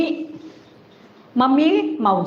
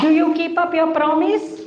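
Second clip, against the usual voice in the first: put-on raised, higher coaxing question to a child; 6 semitones higher than usual. Parent coaching child loudly.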